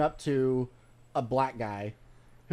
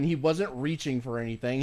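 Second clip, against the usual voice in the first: ordinary talk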